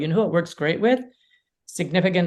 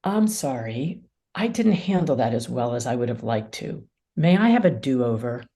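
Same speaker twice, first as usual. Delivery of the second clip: sincere apology; 6 semitones lower than usual. Shown as a demonstration. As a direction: own the mistake gently. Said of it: "Or I can say".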